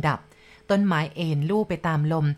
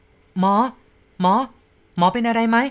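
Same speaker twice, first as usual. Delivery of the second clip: louder, higher calls to wake him; urgent calling; 4 semitones higher, 6 dB louder, slower than usual. A narrator's reading. Young man shaking the limp shaman, calling loudly. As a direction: shake him and call out urgently. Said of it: "เวทาซูเขย่าเรียก".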